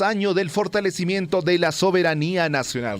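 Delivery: bright, lifted newscast announcer voice; radio news opener. Acted. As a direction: open the bulletin like a radio host. Opens the newscast.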